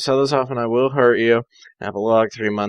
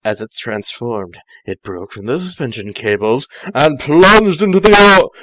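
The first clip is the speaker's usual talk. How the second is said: ordinary delivery, nothing marked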